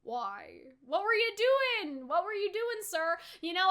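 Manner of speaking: normal talking voice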